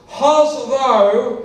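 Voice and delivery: high, loud, drawn-out mock yell; hammed-up roar with stretched words; drunken scream. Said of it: "as he screamed the words".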